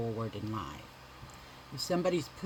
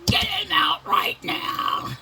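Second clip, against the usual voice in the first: high strained shout, slow and forceful; retold yell; 10 dB louder, slower than usual. Mother yelling at doctors to get baby out, strained shout. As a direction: belt it out like in labor.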